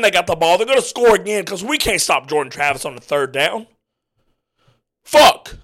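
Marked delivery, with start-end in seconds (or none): 5.0-5.6 high, loud single curse; sharp furious curse. Real, not acted. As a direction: spit it out in real frustration. Opponent about to score again.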